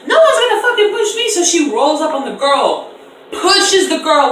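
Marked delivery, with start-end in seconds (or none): 0.1-1.3 high, loud, outraged comeback; indignant retort. Retold or imitated. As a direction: snap back at being pushed. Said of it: "She said, like".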